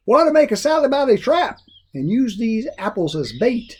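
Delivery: put-on high folksy old man proposing a plan; Grandpa's voice. A narrator's reading. Grandpa suggesting a trap to Jack, in a high old man's voice. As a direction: pitch up for the scheming grandpa.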